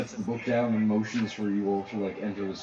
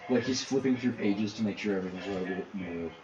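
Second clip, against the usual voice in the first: muttering wizard; 4 semitones lower, 8 dB quieter than usual. Acted. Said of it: "and just goes".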